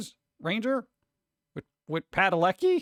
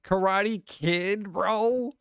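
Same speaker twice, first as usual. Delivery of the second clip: higher, slow stretched disbelief; can't believe they made it; incredulous; 4 semitones higher, much slower than usual. Real, not acted. To the chat, baffled by the new Karate Kid, slow and incredulous.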